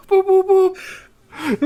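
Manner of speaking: very high, very slow laughing boops; robot beeps. Retold or imitated. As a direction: squeak out robot boops, cracking up. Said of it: "it was the voice".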